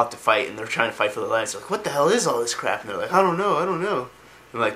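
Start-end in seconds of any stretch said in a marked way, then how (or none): 1.7-2.8 raised-pitch baffled outcry; mock bewilderment. Acted fighters alarmed at undead. 3.1-4.1 high, wailing flustered reply; mock panic. Acted panicked answer during fight.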